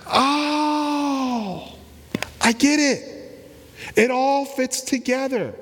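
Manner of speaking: high, bright cry of sudden understanding; sudden realization. Acted. Women in the tomb finally understanding, excited realization. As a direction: let it dawn on you all at once, voice leaping up.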